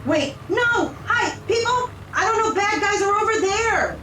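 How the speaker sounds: high-pitched, frantic calling to passengers; acted urgent hero's holler; mock screaming. A narrator's reading. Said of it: "he screams to the passengers".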